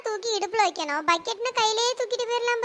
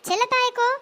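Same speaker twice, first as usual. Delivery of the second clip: calling out crossly; 3 semitones higher than usual. Acted. Neighbour confronting Chellathayi over the water pots, calling out crossly. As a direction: call her out to start a quarrel. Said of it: "ஏன்டி கத்துற?".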